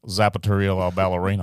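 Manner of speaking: loud ad pitchman; mock commercial read. Guest parodies western store advert, loud ad read. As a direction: announce the store name loudly.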